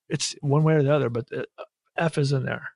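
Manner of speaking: ordinary delivery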